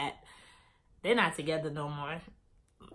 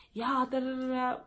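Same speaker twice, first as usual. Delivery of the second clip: mock rant; 6 semitones higher than usual. Mimics friend turning on her.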